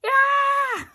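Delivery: high, loud screaming yell; panicked yell. A narrator's reading. Rabbit yelling as he runs around the house. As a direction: scream while running about. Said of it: "ran around the house yelling".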